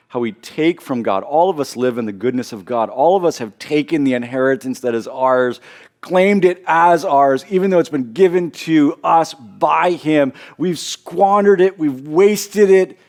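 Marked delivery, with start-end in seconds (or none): 6.0-13.0 louder, higher, slowed emphatic preaching; preaching emphasis. Real, not acted. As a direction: drive each clause home with force. Preacher building to emphatic peak.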